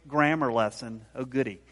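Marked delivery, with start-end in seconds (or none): none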